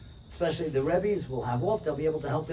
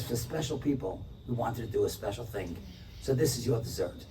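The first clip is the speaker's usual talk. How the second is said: soft, lower tender voice; 6 semitones lower, 5 dB quieter than usual. Retold or imitated. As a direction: say it gently as a gift. Owner's tender gesture.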